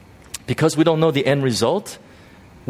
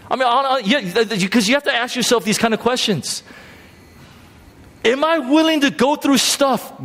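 stirred up; 10 semitones higher than usual. Real, not acted. Preacher stirred, rising pitch.

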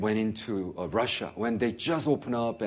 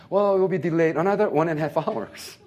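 mock announcement; 8 semitones higher, 7 dB louder than usual. Mocking the airport announcement.

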